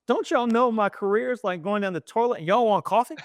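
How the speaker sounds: mock outrage, like the career is sinking; exasperated complaint. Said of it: "I'm like".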